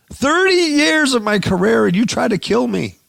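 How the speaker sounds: high, loud mock wail of outrage; hammed-up hysterical cry; mock scream. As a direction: shriek it like a man falling apart. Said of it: "He starts screaming and crying".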